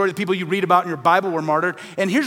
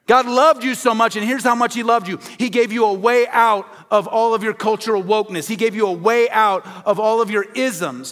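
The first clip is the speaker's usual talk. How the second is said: preaching; 4 semitones higher, 3 dB louder than usual. Acted. Preaching at full force.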